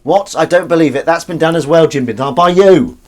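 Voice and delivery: high, loud hammed-up disbelief; mock outrage. Host scolding Jim for a repeated quip, mock outrage. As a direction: exclaim it in rising, exaggerated indignation.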